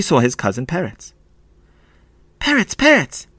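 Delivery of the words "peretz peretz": high-pitched urgent call; excited shout. A narrator's reading. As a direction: call out to your cousin. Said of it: "he shouted".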